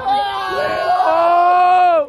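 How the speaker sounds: high loud whoop; excited shout. Real, not acted. Jump cheer.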